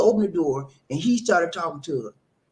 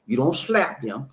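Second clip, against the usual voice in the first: usual speaking voice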